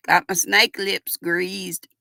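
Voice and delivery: ordinary delivery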